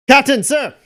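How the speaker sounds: sharp, loud, high "Captain, sir!"; sloppy privates shouting to their captain, snapping to attention; soldiers' shout. Acted. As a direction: bark it out to attention. Drunk privates snap to attention.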